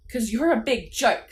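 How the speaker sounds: loud jeering dummy voice; Slappy's yell. A narrator's reading. Dummy mocking the boys, yelled. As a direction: yell the insult loudly. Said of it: "Slappy yelled".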